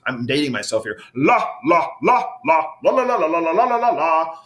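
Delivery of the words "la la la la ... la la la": "la, la" sung higher from the throat. Shown as a demonstration. Leading a group warm-up, voice in the throat. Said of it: "our voice in our throat".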